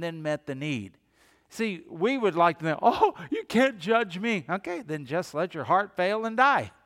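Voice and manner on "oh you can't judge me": high-pitched mock protest; mock indignation. Preacher mimics a person refusing to be judged, mock indignant. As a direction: put on an affronted objector's higher voice. Acting out someone objecting to judgment.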